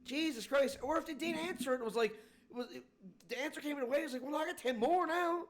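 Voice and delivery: high-pitched, incredulous exasperation; exasperated rant. Real, not acted. Venting to friend about men who can't answer questions, exasperated. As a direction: pitch soars in disbelief.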